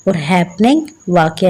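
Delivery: usual speaking voice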